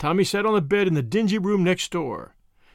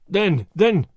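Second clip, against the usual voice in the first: loud, faltering repetition; falter, lost for words and cross; baffled, frustrated stammer; angry and baffled; 3 semitones higher, 5 dB louder than usual. Acted. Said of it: "angry and baffled".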